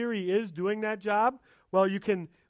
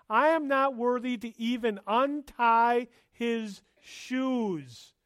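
raised, loud sermon delivery with slow stressed words; fervent preacher voice; impassioned preaching; 5 semitones higher, 3 dB louder, slower than usual. Acted. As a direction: proclaim it to the whole church. Preaching John's humility loudly.

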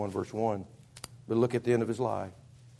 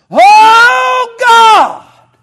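long, very high full-force scream; desperate yell; 24 dB louder than usual. Acted. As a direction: cry out to God at the top of your lungs. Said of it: "he finally yells".

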